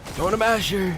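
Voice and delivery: high, loud shouted battle cry; Axton impression. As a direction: yell it like the game hero. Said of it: "This is my impression of Axton".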